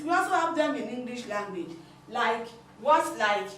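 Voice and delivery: ordinary talk, nothing marked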